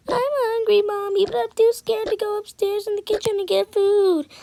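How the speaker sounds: put-on high-pitched whiny child asking mommy; scared little kid. Child character whines to mommy.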